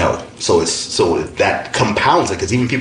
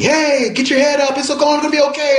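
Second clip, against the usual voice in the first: high mock-cheerful call, much higher than his voice; imitating cheery well-wishers. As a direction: parrot the upbeat neighbors shouting encouragement. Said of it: "people were yelling at me".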